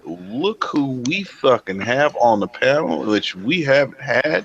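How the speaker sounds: drawn-out hype introduction; slow announcer intro. Acted. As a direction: stretch it out like an MC. Introducing guest on panel.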